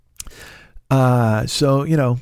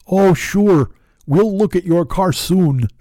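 Paul; 6 semitones higher, 3 dB louder, slower than usual. Retold or imitated.

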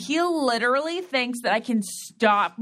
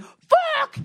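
high-pitched, loud mock scream of frustration; imitated scream; 5 dB louder than usual. Wife imitates her upset husband's outburst, mock scream. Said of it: "he'll, like, scream".

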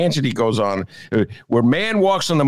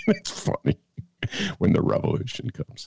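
"when the revolution comes" whispered with a laugh, softer; breathy laughing whisper; 5 dB quieter than usual. Shown as a demonstration. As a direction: savor the punchline under your breath. Repeats the punchline, laughing.

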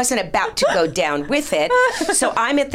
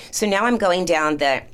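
usual speaking voice

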